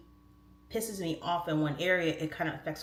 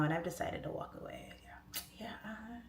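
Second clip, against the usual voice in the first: very soft, near-whispered phone voice; hushed mock breakup; 2 semitones higher, 10 dB quieter than usual. Wife quietly leaving husband by phone, hushed mock-solemn breakup. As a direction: trail down into a whisper.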